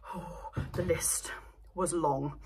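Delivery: slow hushed whisper. Real, not acted. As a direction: breathe it out like a confession. Whispers about the long list.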